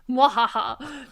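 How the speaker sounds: slow mock evil laugh; villain laugh. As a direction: cackle like a cartoon villain. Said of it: "no-bid contract to Snidely Whiplash".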